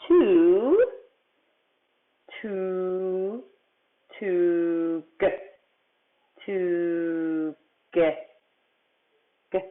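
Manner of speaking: "Two", "G", "Eh" as separate sounds, vowels stretched; sounding out. Shown as a demonstration. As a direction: hold "Two" long, tap "G", then "Eh". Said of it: "sound out big words".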